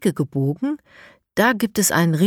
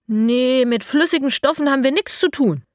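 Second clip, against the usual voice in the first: fending off; 7 semitones higher, 4 dB louder than usual. A narrator's reading. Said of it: "wehrt Sophie ab".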